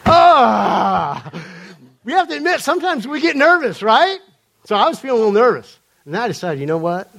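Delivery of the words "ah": drawn-out, hammed-up "Ah!"; mock panicked cry. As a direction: cry out in comic alarm. Mock panic at learning the airline.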